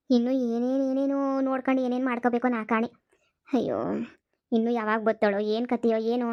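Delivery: normal talking voice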